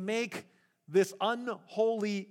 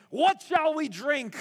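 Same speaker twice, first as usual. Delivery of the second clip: whiny grumble; 7 semitones higher, 5 dB louder, slower than usual. Retold or imitated.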